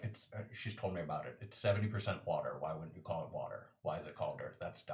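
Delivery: low, soft, fast grumbling; deadpan Gary. Acted. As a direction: mumble it off as obviously dumb. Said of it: "And then Gary is just like".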